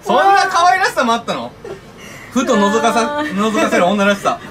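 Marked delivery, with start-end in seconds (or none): 2.3-4.4 tripping over the words, tipsy. Real, not acted. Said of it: "噛まないで".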